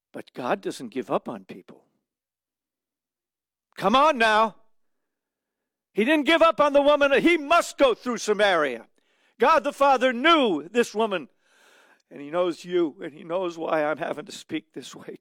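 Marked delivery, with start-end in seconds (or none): 3.8-11.3 higher, louder, driving sermon voice; fiery preaching. Acted. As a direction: preach it out with rising fervour. Preaching at full force.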